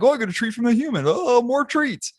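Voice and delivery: high, squeaky dopey dog voice; silly high dog voice; happy dumb dog. Retold or imitated. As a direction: play a goofy, delighted dog. Typical dog voiced happily.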